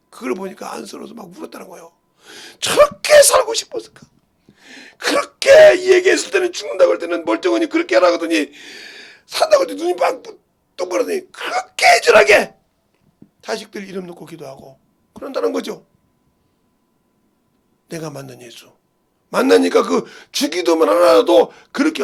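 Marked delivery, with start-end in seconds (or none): 2.6-10.2 high, loud, tearful preaching cry. Real, not acted. Preaching climax, crying out. 10.8-12.6 그렇게 애절하게 cried out high and loud, falling at the end; tearful cry. Real, not acted. Same words earlier whispered. 19.3-22.0 loud, ringing proclamation; loud preaching. Real, not acted. Preaching at full force.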